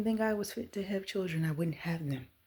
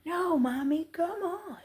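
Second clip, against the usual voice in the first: put-on high, playful child voice, much higher; imitating toddler; 11 semitones higher, 4 dB louder, slower than usual. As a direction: squeak it like a toddler teasing mom. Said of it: "And she's going".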